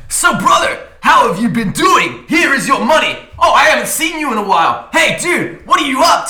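exaggerated bro customer. Driver mocking the unbothered customer, loud hammed-up bro voice. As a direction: ham up an over-cheerful dude. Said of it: "completely deadpan".

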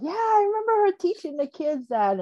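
high, delighted memory; fond recollection. Retold or imitated. Remembering her teaching the kids crochet, high and delighted. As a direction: lift it up, pleased to remember. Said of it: "and I was like".

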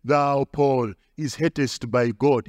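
low-pitched imagined messenger from heaven; angel Michael. Acted. Angel come down in power addressing Paul, low pronouncement. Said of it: "angel Michael came down in power and said".

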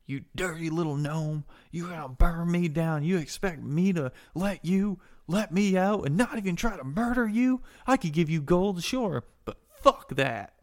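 high, loud, indignant rant; acted anger, scornful and incredulous; vengeful captive raging at a gnome; outraged prisoner. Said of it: "He goes, uh".

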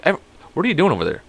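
normal talking voice

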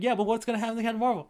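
fast, high, loud alarmed blurt; a panicky official asking about Captain Marvel; alarmed question. Retold or imitated. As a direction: rush it out in mock panic. Worried quote in movie recap.

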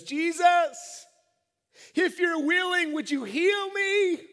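leper calling out for healing; loud, high, drawn-out cry; shouted plea. Acted. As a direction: beg for healing at full volume. Acts leper calling out to Jesus.

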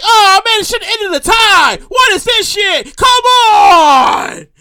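high, loud, drawn-out protest; upset Keith Smith. Retold or imitated. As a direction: imitate an exasperated complaint, building to a shout. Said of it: "Keith Smith was upset".

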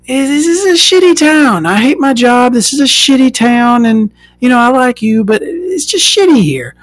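high whiny mock boyfriend voice; mock him up high and whiny. Imitating boyfriend explaining why he's leaving, high whine.

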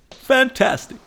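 loud, high-pitched hammed-up cheer; over-the-top delight; exclaiming. Exclaims over the dog's contribution.

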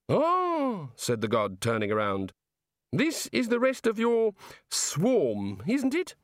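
high, eager voice of the god; high-pitched, fussy god voice. Acted. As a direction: play the chatty, enthusiastic creator god. The god speaks.

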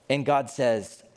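normal talking voice

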